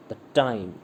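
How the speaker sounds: light "the", weighted TIME in "the time"; stress on TIME. Shown as a demonstration. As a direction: land on "time". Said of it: "time पर है".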